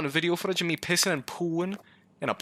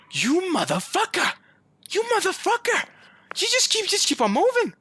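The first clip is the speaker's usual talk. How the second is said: furious yelling; 13 semitones higher than usual. Real, not acted. Player cursing a monster that keeps moving, screaming. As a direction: scream abuse at the thing that won't stay put.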